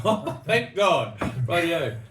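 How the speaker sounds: high, loud, slow "Oh, thank God"; relieved exclamation. Retold or imitated. Relieved to hear the winemaker is Canadian, drawn out. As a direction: stretch it out in big relief. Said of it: "I went".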